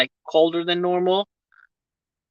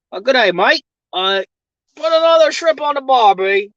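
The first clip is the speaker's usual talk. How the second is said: loud, high cliché Australian; hammed-up Aussie catchphrase voice; put-on Aussie accent; 6 semitones higher, 3 dB louder than usual. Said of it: "Say some more Aussie stuff?".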